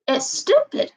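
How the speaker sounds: ordinary delivery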